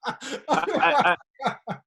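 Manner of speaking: high laughing stammer; giggling as he starts to answer. Real, not acted. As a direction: laugh through the words. Laughing at own yo-yo dieting.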